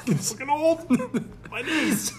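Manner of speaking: put-on high-pitched aching old man; old dad. Voicing the aging dad after the game, high old-man whine. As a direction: complain like a worn-out dad, laughing. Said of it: "your dad's probably like".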